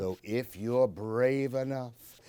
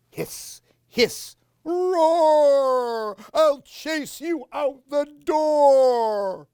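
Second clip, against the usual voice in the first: much higher, loud, slow hammed-up roar; roaring monster; 12 dB louder, much slower than usual. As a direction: scare the boy out the door. Cat becomes monster.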